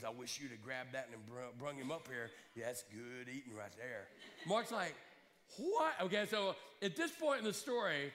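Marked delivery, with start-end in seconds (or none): 0.0-4.1 Bubba voice. Retold or imitated. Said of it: "this big old Bubba kind of guy". 5.6-5.9 high, sharp disbelieving what; stunned what. Retold or imitated. Mark stunned by snake-eating idea.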